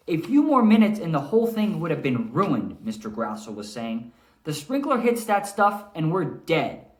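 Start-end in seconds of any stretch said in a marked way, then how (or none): 0.1-2.8 higher, louder, urgent character voice; Mister Grassel telling the manager, alarmed; worried groundskeeper. Acted. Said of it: "kinda like what I just did between Mister Grassel and the manager". 4.4-6.9 higher, louder, urgent character voice; Mister Grassel telling the manager, alarmed; worried groundskeeper. Acted. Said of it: "kinda like what I just did".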